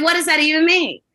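high-pitched incredulous retort; retold exasperation. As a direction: throw it back, incredulous. Retelling exasperated reply to official.

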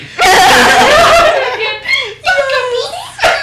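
high squealing laugh; loud high laugh. Real, not acted. Laughing at Togepi image.

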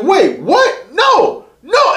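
high, squealing no no; Owen's protest. Retold or imitated. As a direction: imitate a panicked friend, high-pitched. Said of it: "He's like".

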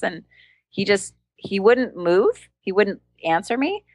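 ordinary delivery

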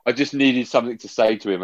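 ordinary talk

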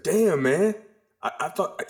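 a stranger blurting out his surprise; surprised guy. Retold or imitated. A guy in the bathroom, surprised, high mock voice. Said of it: "he was like".

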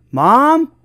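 questioning yell, lightly acted. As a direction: call out for a parent in another room. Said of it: "she yelled out".

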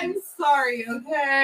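loud, high, exasperated sorry; angry apology. Real, not acted. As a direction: snap the apology back, annoyed. Called useless, snaps back apology.

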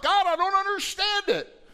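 high, loud anguished cry. Retold or imitated. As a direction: cry it out to God. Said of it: "he's crying out".

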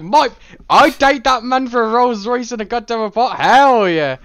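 loud, high, hammed-up eagerness; playfully over-the-top excited yes; emphatic enthusiasm. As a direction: jump at the offer, loud and high. Imagined eager reply to gifts.

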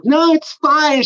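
put-on high, slow, breezy girl voice; airy high-pitched girlfriend impression; girly friend voice. Friend Rachel voiced in story.

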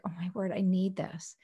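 soft whispered longing; whispered plea. Retold or imitated. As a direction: breathe it out like someone in tears. Voicing tearful person.

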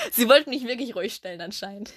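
normal talking voice

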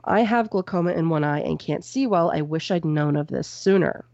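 ordinary delivery

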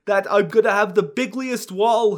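loud, high, bad presidential impression; Trump. Shown as a demonstration. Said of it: "kind of a Bernie Sanders pretending to be Trump".

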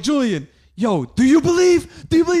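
rally your little fellow fan like a preacher; hyped up. Retold or imitated. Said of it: "I'm getting hyped".

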